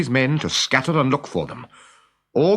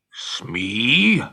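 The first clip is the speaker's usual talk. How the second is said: growling Hook. Acted. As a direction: growl it like a villain savoring revenge. Hook calls his bosun.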